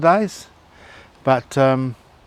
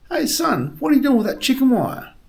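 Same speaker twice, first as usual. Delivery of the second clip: put-on old codger's call, pitched way up; high old man voice; 11 semitones higher than usual. Old man on porch calls to boy, high voice. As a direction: squeak it out like a nosy old man.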